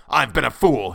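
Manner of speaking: loud, frantic self-reproach; raving. A narrator's reading. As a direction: rave it loudly, like a madman. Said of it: "he raved".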